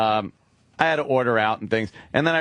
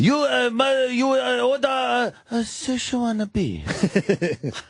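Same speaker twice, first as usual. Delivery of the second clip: very high, hammed-up mock Chinese accent; over-the-top Chinese accent; 10 semitones higher, much slower than usual. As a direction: push the put-on Chinese accent way up high, stretching the end. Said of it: "Bring it down a notch".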